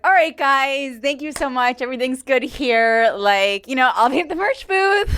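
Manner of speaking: high, mock-cheerful stage goodbye; forced cheery sign-off. Comic closing the show past an upset heckler, forced cheer. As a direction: wrap up the show in an over-bright high voice. Hastily signs off to the audience.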